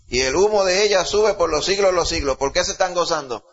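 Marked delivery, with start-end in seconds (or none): 0.1-2.4 loud, high proclaiming of the verse; full-force preaching. Acted. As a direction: proclaim it to the congregation. Preaching the scripture aloud. (predicación a todo pulmón)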